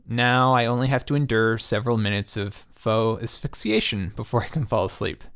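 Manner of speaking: ordinary talk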